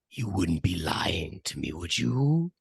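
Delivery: low creature voice, rising at the end; suspicious monster. Acted. Monster shuffles up to Arnold, low suspicious voice. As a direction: sink low, then lift into the question.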